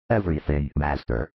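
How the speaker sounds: low-pitched character voice of obedient followers; apostles replying. A narrator's reading. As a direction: drop deep for the disciples' answer. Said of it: "reply the apostles and disciples".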